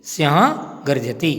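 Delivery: normal talking voice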